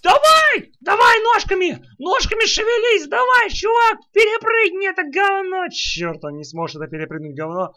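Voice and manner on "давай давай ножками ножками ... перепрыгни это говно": excited shouting. Real, not acted. Urging character to jump.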